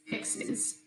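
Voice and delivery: "fixes" whispered; whispered word. Shown as a demonstration. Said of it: "record yourself reading".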